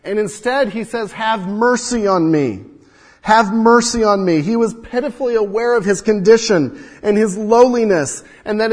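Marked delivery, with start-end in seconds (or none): 1.1-4.4 begging cry. Acted. Blind man begs Jesus.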